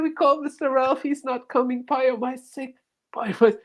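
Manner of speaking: a high fretful impression of the man on the phone; mock stable hand. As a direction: play the worried caretaker.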